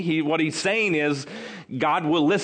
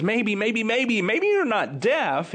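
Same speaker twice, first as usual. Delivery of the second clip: raised, teasing mock doubt; 6 semitones higher, slower than usual. Healed man to questioners who won't listen, mocking. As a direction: pretend to reconsider, making fun of them. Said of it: "he starts to make fun of them".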